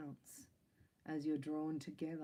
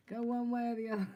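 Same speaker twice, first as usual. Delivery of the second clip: mock bossy; 7 semitones higher, 7 dB louder, faster than usual. I'm only joking.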